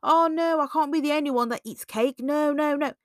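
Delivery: high, loud wheedling insistence; pushy coaxing friend. Retold or imitated. Friend pressing you into cake, loud high coaxing. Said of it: "I'm like".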